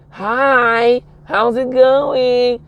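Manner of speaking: high, slow, proper voice. Retold or imitated. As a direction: put on a polite, proper voice. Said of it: "they start".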